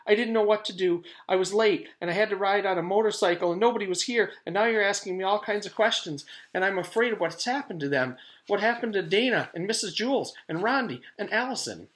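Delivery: high, loud, fast tearful outburst; crying girl. A narrator's reading. Jenny crying to the stranger, high, loud and fast. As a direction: spill it all out between tears. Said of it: "Jenny started to cry".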